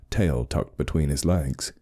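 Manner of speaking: plain reading voice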